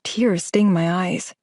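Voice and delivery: ordinary delivery, nothing marked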